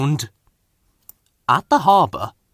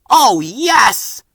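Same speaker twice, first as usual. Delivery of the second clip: much higher, louder eager reply; eager yes; 11 semitones higher, 5 dB louder than usual. A narrator's reading. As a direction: answer with relieved delight. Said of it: "said the twins together".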